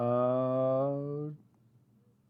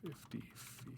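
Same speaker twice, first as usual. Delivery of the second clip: whispered; 3 semitones higher, 20 dB quieter than usual. Real, not acted. Typing, repeating to self.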